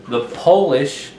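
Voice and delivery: "Polish" with a long o, POH-lish; Polish pronunciation. Shown as a demonstration. Teacher naming the Poland word, "Polish" as POH-lish. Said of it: "as in the country of Poland".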